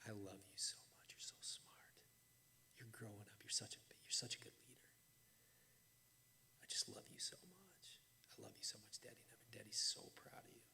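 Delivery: put-on soft loving whisper to a child; tender whisper. Father to his daughter at night, soft loving whisper. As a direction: whisper it like tucking her in.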